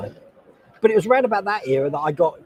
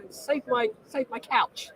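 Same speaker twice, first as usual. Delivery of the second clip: mock panicked lady; 9 semitones higher, 6 dB quieter, slower than usual. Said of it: "And she's like".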